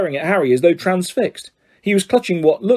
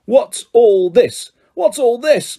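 booming Lockhart; 8 semitones higher, 4 dB louder, slower than usual. A narrator's reading.

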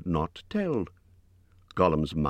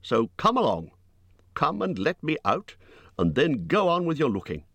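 insistent, raised-pitch protest; crying out; 9 semitones higher than usual. A narrator's reading. Hobbit demands to be shown out as promised, crying out. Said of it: "cried Bilbo".

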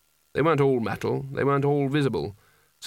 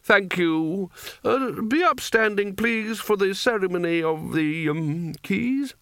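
hesitant wizard; 7 semitones higher, slower than usual. A narrator's reading. Spelter hesitant ceremony speech.

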